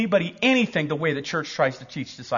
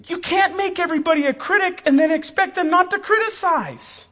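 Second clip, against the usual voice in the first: ordinary talk, nothing marked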